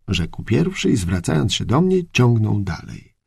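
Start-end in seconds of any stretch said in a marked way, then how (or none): none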